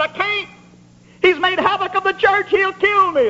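high-pitched mock plea of refusal; acted alarmed protest. Ananias balking at the Lord's order, acted alarm. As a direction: protest in a high preacher's quote.

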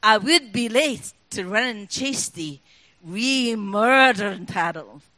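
Burns read in broad Scots, slow and rolling; Scots poem recital. Pretend. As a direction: recite it like a Burns Supper toast. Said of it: "it goes like this".

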